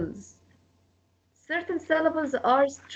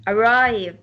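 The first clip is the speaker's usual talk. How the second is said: "Arrive" with weight on RIVE; stress on second syllable; 3 semitones lower, 3 dB louder than usual. Shown as a demonstration. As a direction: lean firmly on the end of "Arrive". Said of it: "So we stress arrive".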